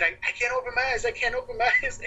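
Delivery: retold drunken plea climbing very high; mock helpless wail, pitch shooting up; helpless protest.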